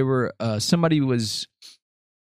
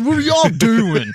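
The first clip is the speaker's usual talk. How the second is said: mock cheery greeting; 10 semitones higher, 6 dB louder, slower than usual. First take of greeting.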